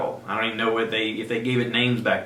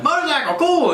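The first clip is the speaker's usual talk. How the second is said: much higher, louder delighted shout; excited kid; 5 dB louder than usual. Retold or imitated. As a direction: squeal with joy at the gift. Boy spots his motorcycle.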